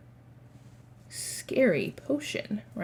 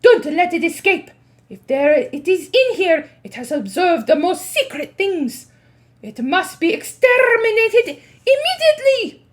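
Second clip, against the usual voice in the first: angry witch; 8 semitones higher, 9 dB louder than usual. Acted. Witch queen demands capture.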